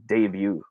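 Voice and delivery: debut softly as day-byoo; proper pronunciation. Shown as a demonstration. Teacher revealing the answer, debut as day-byoo. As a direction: give "debut" a day start and byoo end. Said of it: "the proper way of saying this".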